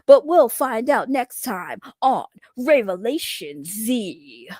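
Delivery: slow, dramatic anime episode-preview announcer; drawn-out cliffhanger narrator voice; Dragon Ball Z voice. Pretend. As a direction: tease the next episode like a hammed-up cartoon narrator. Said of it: "do my Dragon Ball Z voice".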